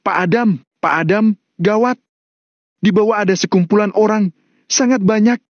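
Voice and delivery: high, urgent warning; alarmed report. Acted. Employee warns the boss of a crowd below, alarmed. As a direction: call the boss in a high, panicky voice.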